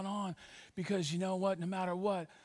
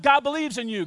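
higher, firmly declared; with conviction; 7 semitones higher, 9 dB louder than usual. Shown as a demonstration. Pastor showing the church how to say it, with conviction. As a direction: proclaim it so they feel it. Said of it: "say it with some conviction".

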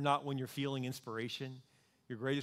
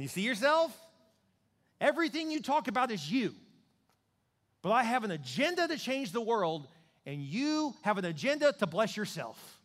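rebuking God; 7 semitones higher, 5 dB louder than usual. Retold or imitated.

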